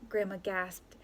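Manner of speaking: ordinary delivery, nothing marked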